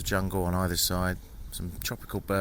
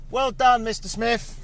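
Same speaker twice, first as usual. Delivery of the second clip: high, loud call across the riverbank; 6 dB louder, slower than usual. Real, not acted. Praising the boatman from a distance, called out loud and high. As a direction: call out cheerfully to be heard from afar.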